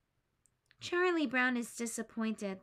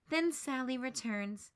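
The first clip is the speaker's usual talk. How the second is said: plain reading voice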